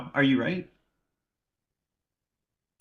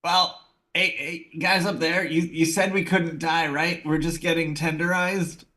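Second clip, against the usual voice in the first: higher-pitched in-character call to people above; mock-worried shout up to someone overhead; 7 semitones higher, 4 dB louder than usual. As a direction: call up to them, pitched high and questioning. Player calls up to unseen voices.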